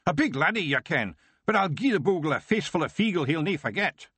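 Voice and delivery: high, rough Scots wee-man threat; a tiny brawler itching for a fight; wee dangerous Feegle. Acted. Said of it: "added wee dangerous Spike".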